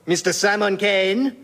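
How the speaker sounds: hammed-up French introduction; outrageous French accent. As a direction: present them grandly in a French accent. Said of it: "the outrageous French accent".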